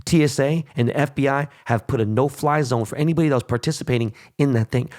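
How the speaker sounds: usual speaking voice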